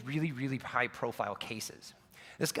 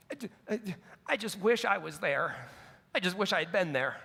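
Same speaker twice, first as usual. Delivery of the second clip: tearful father; 7 semitones higher, 3 dB louder than usual. Retold or imitated. Father regretting his absence to his son, mildly tearful. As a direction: voice lifted and breaking, mildly imitating a sob. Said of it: "And he's just sobbing".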